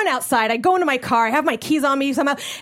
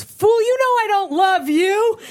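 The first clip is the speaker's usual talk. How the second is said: mock diva; 9 semitones higher, 4 dB louder, slower than usual. Girlfriend rejecting proposal coldly.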